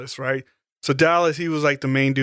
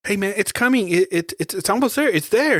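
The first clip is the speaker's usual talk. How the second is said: put-on urgent tracker girl; 6 semitones higher than usual. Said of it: "she's like".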